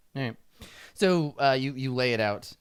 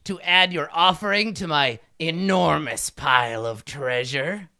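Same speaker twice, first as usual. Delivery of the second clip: raised, drawn-out regal demand; imperious kobold king; 4 semitones higher, 5 dB louder, slower than usual. Acted. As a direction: demand tribute grandly. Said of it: "and he says".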